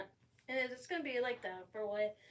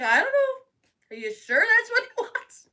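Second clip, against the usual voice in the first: laughing through words; 13 semitones higher, 13 dB louder than usual. Retold or imitated. Retold doubt, laughing.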